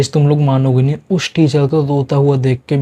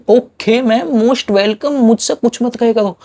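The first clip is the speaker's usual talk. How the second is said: high, slow sarcastic drawl of thanks; 7 semitones higher, slower than usual. Retold or imitated. Student mocking the teacher with a sarcastic reply. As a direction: stretch out a mock-polite okay ma'am. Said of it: "मैंने कहा".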